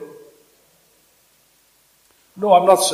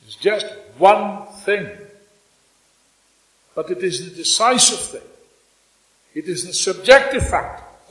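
rally the comrades, rising; fiery revolutionary speech; 4 dB louder than usual. Acted. Rousing political speech.

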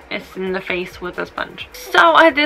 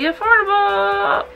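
high, slow, sing-song product pitch; mock ad voice; 10 semitones higher than usual. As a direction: sell it like a cheesy commercial. Drawn-out high ad tone.

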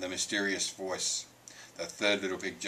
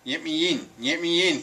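loud, higher, through a clutched nose; pinched-nose wolf; 8 semitones higher, 7 dB louder than usual. A narrator's reading. Wolf with hurt nose begs entry, pinched voice. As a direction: plead as if holding your hurt nose. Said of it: "clutching his nose".

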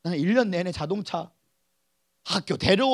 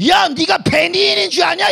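louder, higher mocking jab; scornful aunt; 9 semitones higher, 8 dB louder than usual. Aunt sneering at her nephew about being Benny, mocking and loud. Aunt scolding him, quoted.

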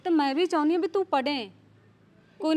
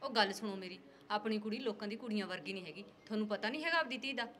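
softer, lower plea to husband; soft pleading; 5 semitones lower, 12 dB quieter than usual. Acted. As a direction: coax the husband gently and quietly. ਮਾਂ ਪਤੀ ਨੂੰ ਮਨਾਉਂਦੀ. (ਹੌਲੀ ਆਵਾਜ਼ 'ਚ ਮਨਾਉਣਾ)

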